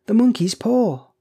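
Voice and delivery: high, loud, frantic exclamation; wild cry. A narrator's reading. Wife waking her husband, wild cry. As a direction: burst out wildly, pitched right up. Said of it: "she cried wildly".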